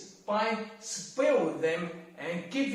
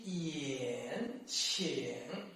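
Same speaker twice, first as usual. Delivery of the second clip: "yan" and "qian" dipping low, then rising; soft, low scoop on "qian"; third tone; 6 semitones lower, 6 dB quieter than usual. Shown as a demonstration. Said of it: "third tone".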